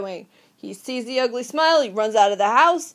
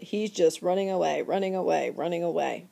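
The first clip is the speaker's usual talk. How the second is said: each repeat softer and lower; fading away; 5 semitones lower, 7 dB quieter than usual. Shown as a demonstration. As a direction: let the words trail off into the distance. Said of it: "repeated fading phrase".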